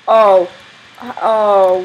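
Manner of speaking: loud startled oh; shocked oh. Real, not acted. Shocked at the enemy's hiding spot.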